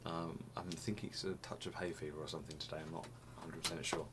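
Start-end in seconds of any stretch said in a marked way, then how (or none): none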